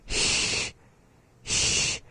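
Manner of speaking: H as a long voiceless hiss, like the start of "human"; isolated hissed sound. Shown as a demonstration. Teacher showing the first sound alone, hissed H. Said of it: "So the first sound is".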